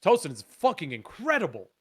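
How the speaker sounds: usual speaking voice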